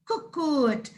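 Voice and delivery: ordinary talk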